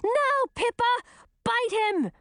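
high-pitched urgent shout; shouted command. A narrator's reading. Gracina orders her snake to bite the beast, shouted. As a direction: cry out an order to attack. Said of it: "she shouted".

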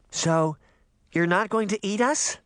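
put-on high, nervous little girl voice; timid child asking; young girl. A narrator's reading. Young girl asks the witch.

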